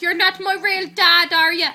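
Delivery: loud, high retold row; mock angry outburst. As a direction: act out a daughter yelling at her dad. Retold argument with dad.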